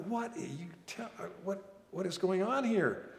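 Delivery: high, baffled disbelief; stunned, can't follow what's happening; bewildered. Retold or imitated. Stunned thought at sergeant's reply.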